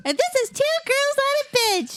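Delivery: high, giggly cute voice; squeaky sweet and bubbly. Pretend. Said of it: "I said I was gonna be cute and, like, have, like, a cute voice".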